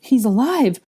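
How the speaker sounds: high, loud, urgent relief; excited cry, building; shouting. Acted. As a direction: shout the good news to a friend. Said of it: "Ginny shouted".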